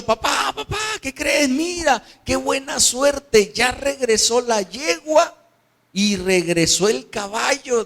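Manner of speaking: very high, thrilled calling to papa; excited son. Acted. Boy telling his father the mare returned, excited. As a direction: shout the good news like an overjoyed youth. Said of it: "Y el muchacho".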